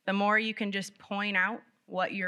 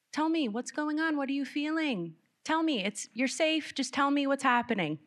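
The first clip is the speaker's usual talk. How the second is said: high, insistent questioning; pressing parent; 7 semitones higher than usual. Retold or imitated. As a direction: keep pressing the child, pitched up. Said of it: "old phrases".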